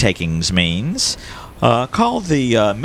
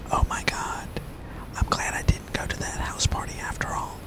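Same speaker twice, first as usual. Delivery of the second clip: a soft shy unvoiced whisper; whispered like Simon; 11 dB quieter than usual. Shown as a demonstration. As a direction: breathe it out barely audible, shy. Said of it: "I'll do it like Simon".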